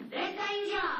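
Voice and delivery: high loud warning shout; alarm call. Pretend. Dog warns the pack, a high loud shout.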